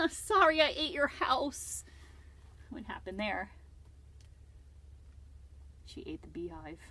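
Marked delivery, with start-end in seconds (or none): 0.1-1.8 put-on slow, high apologetic girl voice; Lucy sheepish.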